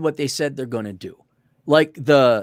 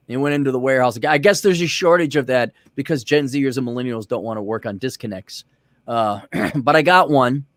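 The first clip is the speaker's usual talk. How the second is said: normal talking voice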